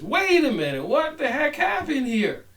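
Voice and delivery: high, alarmed cry of dismay; a damned senator realizing he was tricked; shocked senator. Acted. The senator in hell, shocked and outraged.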